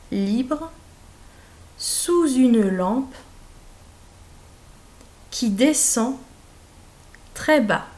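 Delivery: slow word-by-word dictation; dictation reading. Shown as a demonstration. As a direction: read out each group clearly for pupils. Dictée lente, mot par mot. (dictée lente et articulée)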